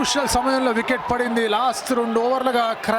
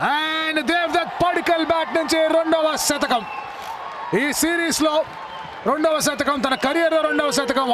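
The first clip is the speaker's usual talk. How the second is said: excited century call; 6 semitones higher than usual. Real, not acted. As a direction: call the milestone with big excitement.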